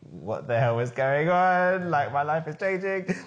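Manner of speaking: high, drawn-out comic wail of disbelief; exaggerated bewildered cry, half laughing; mock panic. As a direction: wail it slowly, rising, in mock alarm. Acting out his new-dad panic.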